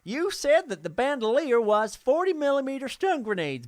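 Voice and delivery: ordinary talk, nothing marked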